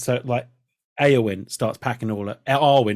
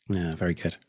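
ordinary delivery

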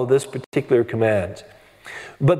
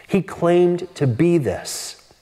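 slow emphatic point; much slower than usual. Real, not acted. Preacher repeats key claim.

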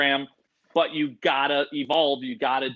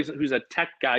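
normal talking voice

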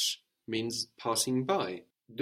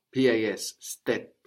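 ordinary delivery

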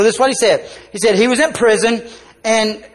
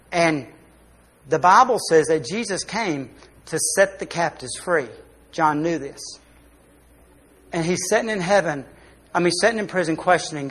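ordinary talk

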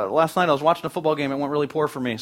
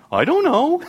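put-on high squeaky kid denial, higher pitch; innocent kid; 12 semitones higher, 5 dB louder, slower than usual. Child with chocolate face denying to parent, high kid voice. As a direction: play the guilty child feigning innocence. Child denies eating brownies.

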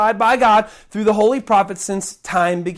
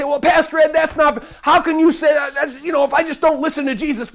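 objecting churchgoer; 5 semitones higher than usual. Acted. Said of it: "people might say".

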